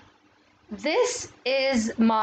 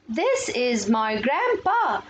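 very slow, separated English words; slow model reading; much slower than usual. Shown as a demonstration. Teacher models a textbook sentence for pupils, slow modelling. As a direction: draw out each word for young learners.

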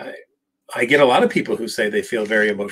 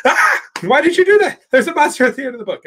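much higher, fast, panicky puppet voice; panicked Grover; 5 dB louder than usual. Retold or imitated. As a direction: squeal it like a frantic little monster. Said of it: "He goes".

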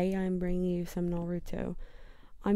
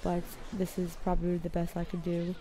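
put-on amateur London accent, done for fun; playful, imperfect London accent. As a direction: talk like a Londoner out of Harry Potter. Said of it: "a London accent".

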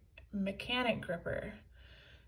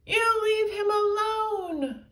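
high, slow, loud defiant shout; defiant yell; 11 semitones higher, 7 dB louder than usual. A narrator's reading. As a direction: yell to protect him. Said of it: "yelled George".

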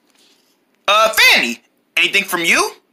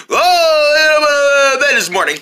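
whiny, high mock version of a friend; teasing a friend by mimicking him in a whiny voice; mocking imitation; 7 semitones higher, 3 dB louder than usual. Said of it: "Would you stop mocking me".

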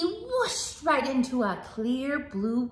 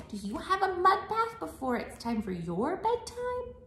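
plain reading voice

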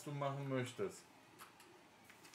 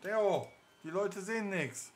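calling out; 5 semitones higher, 6 dB louder, slower than usual. Real, not acted. Father calls out to child.

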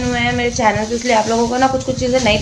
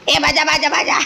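imitating baby babble; 4 semitones higher than usual. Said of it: "अब बात करने की कोशिश करता है".

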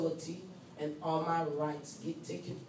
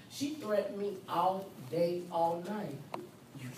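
ordinary talk, nothing marked

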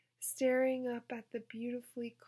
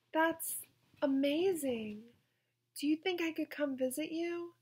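excited; 4 semitones higher, 5 dB louder than usual. Acted. Janey excited about Vegas.

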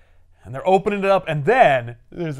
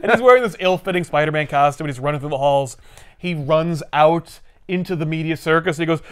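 ordinary delivery

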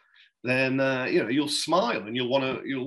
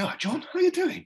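much higher, bright breezy hello covering awkwardness; brazen cheeriness. Retold or imitated. Greeting the angry deputy prime minister, brazenly cheery. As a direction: breeze up to him as if nothing happened. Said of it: "sort of brazen it out".

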